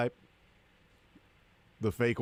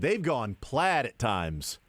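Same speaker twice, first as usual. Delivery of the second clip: ordinary talk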